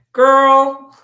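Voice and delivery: loud scolding call to the reader; exasperated. Real, not acted. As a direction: call her out, loud and knowing.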